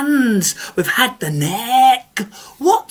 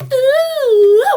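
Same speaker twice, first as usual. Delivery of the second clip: ghostly moan; 13 semitones higher, 6 dB louder than usual. Acted. Spooky story moan.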